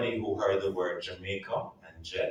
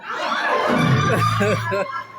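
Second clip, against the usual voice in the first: loud high laugh; 9 dB louder than usual. Real, not acted. Kids' joke told.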